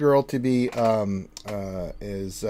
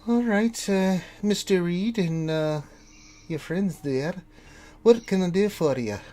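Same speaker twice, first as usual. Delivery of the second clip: higher character voice, businesslike; raised pitch, settling as she offers help; gnomish daughter; 7 semitones higher, slower than usual. Acted. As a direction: turn to the visitors as the daughter.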